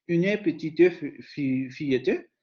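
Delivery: "une petite fillette" with every mute e voiced; slow demo, sounding the final e in "fillette"; southern French vowels. Shown as a demonstration. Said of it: "se va a pronunciar con esas vocales".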